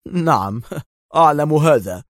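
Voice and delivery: a short chuckle in the reply; amused, lightly laughed answer; laughing. A narrator's reading. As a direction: say it through a small laugh. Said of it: "ضحك يائيل وهو يقول".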